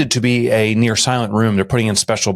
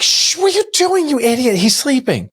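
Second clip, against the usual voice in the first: high mock scolding wife; imitating wife; 14 semitones higher than usual. Husband imitating his wife's angry shush.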